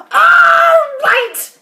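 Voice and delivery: high, drawn-out, outraged yell; Grandma yelling. A narrator's reading. Grandma outraged at George's reassurance, yelling. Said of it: "she yelled".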